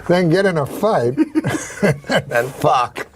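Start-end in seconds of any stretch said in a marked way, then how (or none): 1.2-1.8 high burst of laughter. Real, not acted. Laughing while telling the dirty farm joke, high laugh. 1.8-3.1 slow punchline. Real, not acted. Deadpan punchline after laugh.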